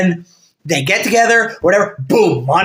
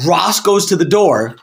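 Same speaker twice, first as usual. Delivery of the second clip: ordinary delivery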